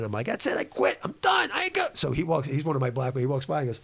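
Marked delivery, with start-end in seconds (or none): 0.3-1.9 high mock whimpering sob; hammed-up fake cry of quitting, much higher. Hiker fake-crying on the trail to quit, high and whiny. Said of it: "I'm faking, I'm crying".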